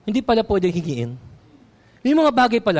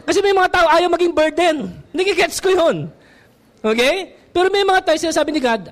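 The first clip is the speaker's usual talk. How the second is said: ordinary talk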